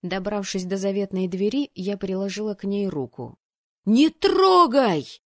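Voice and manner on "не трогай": high, drawn-out warning yell; loud, urgent cry of alarm; desperate shout. A narrator's reading. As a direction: shout desperately to stop someone. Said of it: "отчаянно крикнул кто-то".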